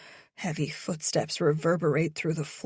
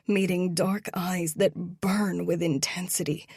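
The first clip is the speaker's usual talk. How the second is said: usual speaking voice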